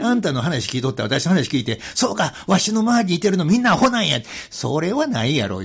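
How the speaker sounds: firm Kansai rebuke; emphatic retort. Retold or imitated. Monk retelling his retort to a smug man: loud Kansai rebuke. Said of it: "って言って".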